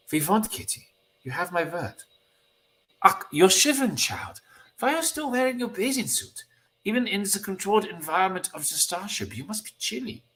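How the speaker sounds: put-on high, lilting German-accented voice; solicitous German teammate. Teammate worried that the child is shivering, high German-accented concern.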